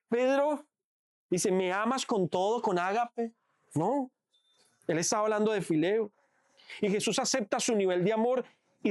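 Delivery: soft, intimate sermon voice, drawing the congregation in; hushed preaching. Acted. Preacher softly explaining Jesus restoring Pedro, hushed.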